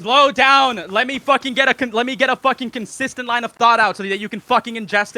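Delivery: loud, high, angry demand; exasperated outburst. Real, not acted. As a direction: shout to get a word in. Demands room to finish, angry.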